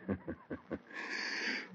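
quiet breathy villain laugh; soft sinister chuckle. Acted. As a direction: chuckle softly with menace.

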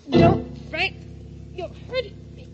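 high panicked cries for help; terrified. Acted. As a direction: gasp it out while being choked. Wife being strangled.

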